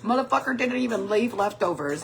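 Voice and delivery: usual speaking voice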